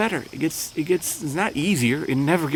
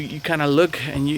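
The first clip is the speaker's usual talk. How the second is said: ordinary talk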